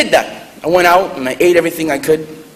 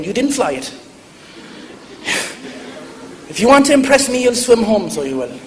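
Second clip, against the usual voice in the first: put-on higher-pitched Irish-accented challenge; Irish grandmother; 6 semitones higher than usual. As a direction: scold like an Irish granny taking someone down a peg. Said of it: "she has an Irish accent".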